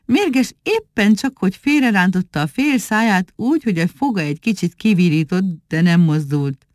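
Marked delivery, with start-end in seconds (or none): none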